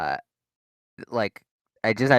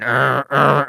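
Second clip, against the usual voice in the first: loud repeated alarm buzzes; imitated fire alarm; 3 semitones higher, 9 dB louder than usual. Alarm going off yet again, mimicked buzzer sound. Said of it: "it did the fire drill sound".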